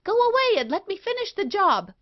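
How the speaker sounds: high, fast, desperate snapping; frantic. Acted. Wife frantically ordering her husband out. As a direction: hurry him away in a high panic.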